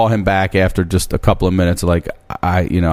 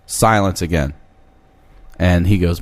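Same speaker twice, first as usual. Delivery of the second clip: usual speaking voice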